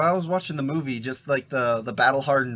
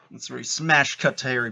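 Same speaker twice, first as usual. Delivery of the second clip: ordinary talk, nothing marked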